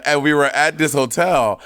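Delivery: usual speaking voice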